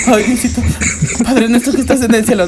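high, laughing plea to heaven; hammed-up scared praying; mock-frightened prayer. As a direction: pray in comic panic. Acts terrified praying at night.

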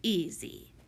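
low, smug little aside; smirking. A narrator's reading. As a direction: say it through a smirk. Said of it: "gave a smirk and said".

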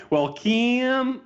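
high-pitched Eastern Tennessee twang; Eastern Tennessee accent. Acted. Nephew slipping into his aunt's Eastern Tennessee accent. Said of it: "Eastern Tennessee sort of accent".

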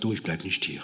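low, soft aside to his wife; hushed aside. Retold or imitated. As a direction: keep it discreet, under your voice. (leise, tief beiseite gesprochen)

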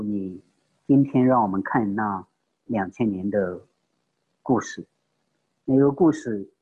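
low, earnest prayer voice; preacher prays to God for the congregation, earnest and low; closing prayer. Real, not acted. Preacher leads the church in prayer, low and earnest.

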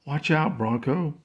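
calling out. Acted. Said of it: "Robin called".

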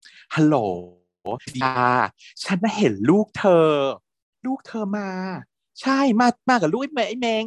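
high-pitched mock phone call, tipping off a mother; put-on high voice of a tattling caller; phone tattler. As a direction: act out an eager informant on the phone, pitched high. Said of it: "โทรหาแม่ แล้วก็แบบ".

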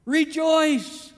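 ordinary delivery

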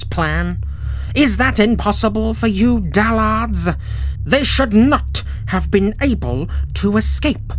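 a general scorning his crew; calm menace. Acted. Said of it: "he said calmly".

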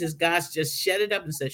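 ordinary talk, nothing marked

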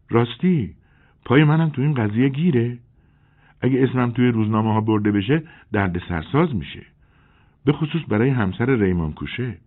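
a woman fretting over the newspapers; worried young woman. A narrator's reading. Nine, surprised, worried about papers. (زن جوان نگران، با صدای بالاتر)

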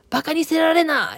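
high, loud angry snap in Okayama dialect; angry Okayama scolding. Retold or imitated. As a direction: scold like Yasuko, sharp and loud. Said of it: "岡山弁じゃなーって思うて". (岡山弁で怒鳴るように)

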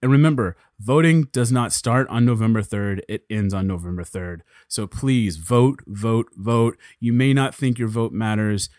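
rally speech. Real, not acted. Urging listeners to go vote.

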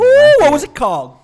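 high, loud eager question; excited. Real, not acted. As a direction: squeal it with delight. Eager question about restaurant.

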